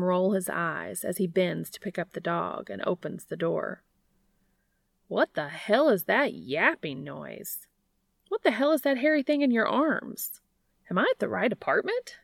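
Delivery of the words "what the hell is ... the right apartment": mock outrage at the yapping dog; brash brother Gabriel.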